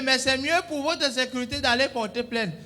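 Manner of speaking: ordinary delivery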